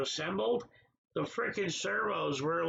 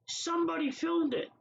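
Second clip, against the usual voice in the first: exasperated outburst; 9 semitones higher than usual. Real, not acted. Ranting about the caught abuser.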